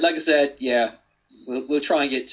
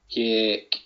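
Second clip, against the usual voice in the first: put-on one word in a Geordie accent. Co-host repeating the running joke, Geordie accent. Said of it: "in that kind of Geordie accent".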